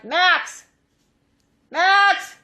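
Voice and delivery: loud call across the house. Real, not acted. Calling child back inside.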